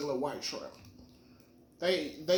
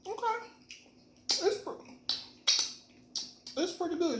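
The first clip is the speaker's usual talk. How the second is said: high pleasantly surprised tasting reaction; bright high pitch after the first taste. Real, not acted.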